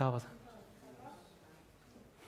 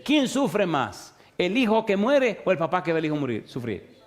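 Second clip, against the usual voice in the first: preaching at full force; 3 semitones higher than usual. Acted. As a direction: drive each yes-or-no question home to the flock. Preacher drills the congregation.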